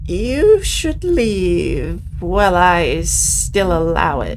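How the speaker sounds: slow, drawn-out menacing witch; distant witch voice. Acted. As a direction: threaten from all around. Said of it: "a distant voice reaches you".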